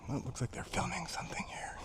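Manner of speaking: hushed unvoiced whisper, trying not to disturb. Real, not acted. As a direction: whisper so the film crew won't hear. Whispers near a film shoot.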